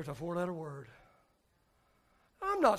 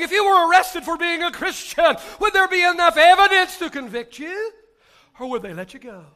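preaching; 11 semitones higher, 15 dB louder than usual. Real, not acted. Challenging congregation, preaching loudly.

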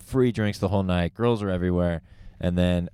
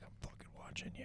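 fast menacing whisper; a fast whispered warning; 27 dB quieter, much faster than usual. Retold or imitated. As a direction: hiss the threat under your breath. Mary threatening from across room.

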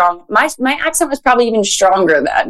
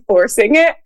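ordinary talk

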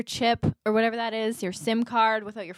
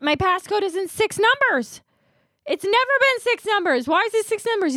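high-pitched exasperated whine, louder; high indignant whine; 12 semitones higher, 3 dB louder than usual. Retold or imitated. Retelling to her friend, mock whining at the Bell guy. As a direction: whine it like a wronged customer.

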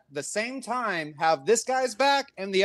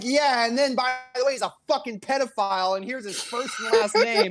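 imitating Mersh, higher; 7 semitones higher than usual. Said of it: "And he's like".

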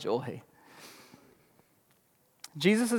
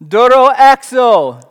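loud, higher, slow booming command; epic Greek command; 5 semitones higher, 11 dB louder, slower than usual. Acted. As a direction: proclaim "Doro exo" like thunder. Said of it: "That sounds epic when you say it in Greek".

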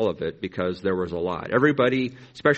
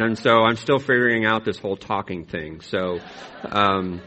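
usual speaking voice

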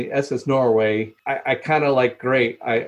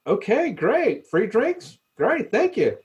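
cheery grateful passengers; 8 semitones higher than usual. Retold or imitated. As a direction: play the easily pleased, grateful cruise passenger, pitched well up and unhurried. Easygoing Midwest passengers, quoted.

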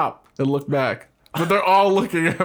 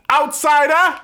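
high, loud mock yell of an accusation; mock shout; 10 semitones higher, 5 dB louder, slower than usual. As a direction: shout it like a crowd calling you out. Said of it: "they were just calling me".

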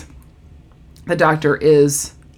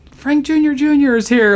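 higher-pitched retold announcement; excited; 7 semitones higher than usual. Brother runs out to the lobby, excited. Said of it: "he's all excited".

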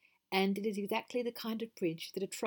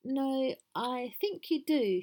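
higher, slow, puzzled contradiction; confused; 7 semitones higher, slower than usual. A narrator's reading. Mrs Gruff correcting the voice under the bridge, confused. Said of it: "Missus Gruff was confused".

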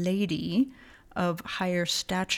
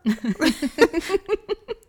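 high laugh; 10 semitones higher, 6 dB louder than usual. Real, not acted. Laughs at her own answer.

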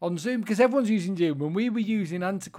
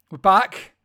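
We're back shouted much louder; 3 semitones higher, 11 dB louder than usual. Acted. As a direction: belt it out, rising.

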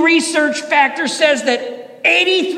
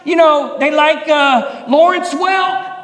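fired-up preaching; 2 semitones higher than usual. Acted. Preaching at full force.

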